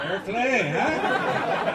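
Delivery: high, loud amused approval; mock German approval. Comic to audience, mock German approval. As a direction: beam out a delighted German "fair play". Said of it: "I was like".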